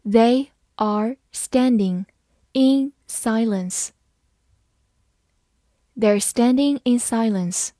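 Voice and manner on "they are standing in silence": very slow, words spaced apart; slow read. Shown as a demonstration. Teacher modelling the sentence for students, slow and clear. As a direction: read it slowly for the learners. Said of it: "好，慢慢来读".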